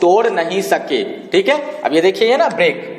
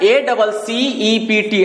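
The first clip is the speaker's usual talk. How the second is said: spelling it out; much slower than usual. Shown as a demonstration. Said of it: "एक बार फिर से देखिए".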